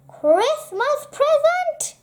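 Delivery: high, indignant rising question; snorting disbelief. A narrator's reading. Badger answering Squirrel's offer of a present, snorting. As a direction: throw it back in scornful surprise. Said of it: "snorted Grumpy Badger".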